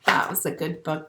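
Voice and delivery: plain reading voice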